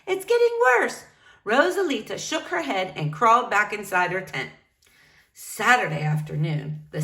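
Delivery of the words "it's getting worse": begging. Acted. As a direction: beg her to come down. Staff begging in storm.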